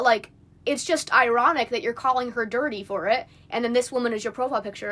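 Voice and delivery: ordinary delivery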